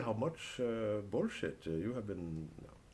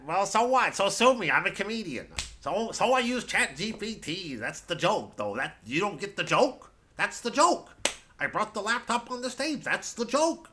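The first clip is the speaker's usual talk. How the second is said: hoch und laut, trotzig verteidigt; trotziger Komiker; 9 semitones higher, 6 dB louder than usual. Pretend. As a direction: spiel den beleidigten Komiker, der sich rechtfertigt. Mocks a defensive comedian using ChatGPT.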